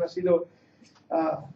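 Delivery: ordinary delivery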